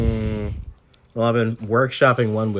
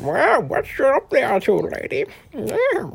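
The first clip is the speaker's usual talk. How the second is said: put-on high, slow toothless old man; old man without teeth; 13 semitones higher, slower than usual. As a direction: gum the words like an old man. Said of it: "What's my old man voice?".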